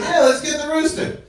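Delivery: high-pitched, gung-ho yes; eager agreement. Retold or imitated. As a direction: act out happily going along. Acted eager decision.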